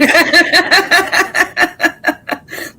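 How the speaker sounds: cracking up high and loud; high laughter. Real, not acted. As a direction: burst of high squealing laughter. Teasing banter.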